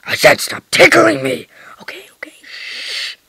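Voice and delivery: hushed unvoiced repeat; whispering. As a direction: whisper it so nobody hears. Whispered repeat of the tickle protest.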